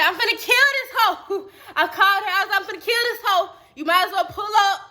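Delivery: high, excited retold rant; hyped-up mock fury, pitched high; worked-up threat. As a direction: rant into the phone, half laughing. Retold angry call to sister.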